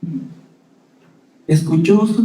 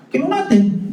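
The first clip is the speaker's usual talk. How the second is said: high emphasis; 12 semitones higher than usual. Real, not acted. Rhetorical emphasis.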